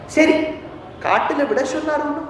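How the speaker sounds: acted hurt indignation; indignant acceptance. Sita reacts to being abandoned.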